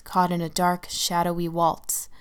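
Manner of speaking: usual speaking voice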